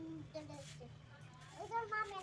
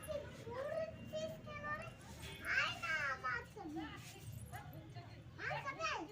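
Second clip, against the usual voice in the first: coaxing call; 6 semitones higher than usual. Real, not acted. Child coaxing cat with fish.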